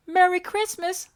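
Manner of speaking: small eager monster voice; Elmo voice. A narrator's reading. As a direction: squeak it up like Elmo. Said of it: "said Elmo".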